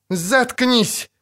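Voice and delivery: high-pitched irritated retort; angry snap. A narrator's reading. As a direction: cut him off angrily. Sorceress silences witcher again. (раздражённо оборвать)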